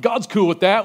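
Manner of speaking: mock-casual shrug of a line; flippant mock claim. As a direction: toss off "God's cool with that" like it's obvious. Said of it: "someone that doesn't care what God".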